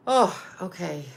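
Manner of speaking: ordinary talk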